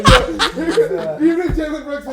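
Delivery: high, very loud laughing shout; real incredulous howl of laughter; laughing outburst. Real, not acted. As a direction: crack up while shouting, drawn out. Laughing outburst at co-host's far-fetched hope.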